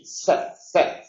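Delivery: Z, Z hissed with the tongue on the teeth; voiceless dental hiss for Z, Z. Shown as a demonstration. Teacher demonstrating the Andalusian and American dental S. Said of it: "se coloca sobre los dientes".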